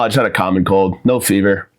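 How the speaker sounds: ordinary talk, nothing marked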